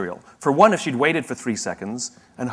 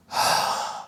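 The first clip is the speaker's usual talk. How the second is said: begrudging sigh. Retold or imitated.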